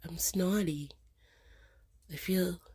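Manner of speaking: congested whisper. Real, not acted. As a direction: whisper it, stuffed up. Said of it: "I don't like the way my voice sounds".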